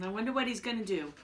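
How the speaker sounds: ordinary delivery, nothing marked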